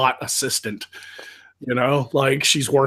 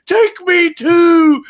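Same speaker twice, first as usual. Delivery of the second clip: slow, higher, louder pleading yell; desperate shout; 13 semitones higher, 11 dB louder, much slower than usual. Retold or imitated. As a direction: beg to be shot, hammed up. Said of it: "the leader, he was like".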